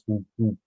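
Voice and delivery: two low, dull booms; a short low thud-thud in your own mouth; imitated thumping noise. Man recalls noise as new track starts, imitated thump. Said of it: "That's not a bass line".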